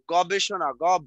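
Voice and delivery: ordinary delivery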